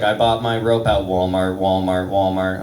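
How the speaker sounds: low monotone ghost reply; ghost's answer. Acted. Voices the ghost's reply via app.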